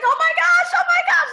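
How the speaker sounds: high, loud excited exclamation; thrilled squeal. Retold or imitated. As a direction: imitate her overjoyed shriek at a first sale. Retelling client's thrill at first sale.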